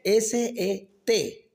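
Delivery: S E T, each letter spoken on its own; spelled out. Shown as a demonstration. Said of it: "spelled out".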